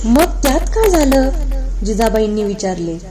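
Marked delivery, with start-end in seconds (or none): 0.0-1.3 high, loud puzzled question; brushing it off as trivial. Acted. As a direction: ask as if it is nothing at all. Jijabai questions the old servant.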